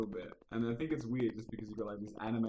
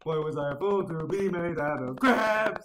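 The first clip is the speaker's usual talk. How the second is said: hammy lament; 5 semitones higher, 7 dB louder than usual. Pretend. Meme line, mock lament.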